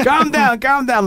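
high, mock-calming repeat; mock soothing. Telling the cop to calm down.

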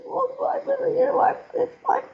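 high, tearful voice near breaking; tearful pleading. Retold or imitated. Dancer pleading through tears to her angry uncle. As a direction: plead through tears that you are not bad. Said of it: "oh, I was crying".

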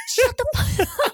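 high, loud, strange shriek; shrieking ghost. Retold or imitated. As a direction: shriek it in a twisted voice. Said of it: "Was like".

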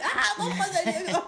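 high mock tantrum wail through laughter; mimicking the wail. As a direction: copy the kid's whine while laughing. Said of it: "J'adore comment il dit".

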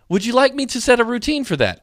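high, mock-helpful assistant voice. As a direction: imitate an over-eager smart speaker. Voices the chatty device.

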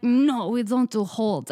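slow, forceful mock Russian accent; heavy, drawn-out Russian-accented refusal; aggressive Russian accent. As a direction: imitate a stern Russian shop worker. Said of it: "it was, like, very aggressive".

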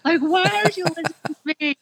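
put-on high, loud exasperated yell at the kids; freaking-out mom yelling. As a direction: reenact a mother losing it at bedtime. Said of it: "so I'm, like, freaking out 'cause I'm like".

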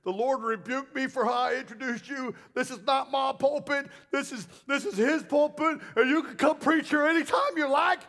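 high, emotional retold apology; retold weeping pastor. Humbled pastor apologising to the guest preacher, mock tearful. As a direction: plead it high, rising in intensity. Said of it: "the pastor comes up on stage weeping".

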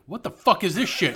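loud, high comic disbelief; outraged outburst. Retold or imitated. Phone owner reacts to Siri's new accent, loud mock outrage. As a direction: blurt it out with a laugh behind it.

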